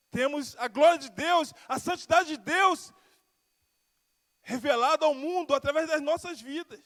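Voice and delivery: ordinary talk, nothing marked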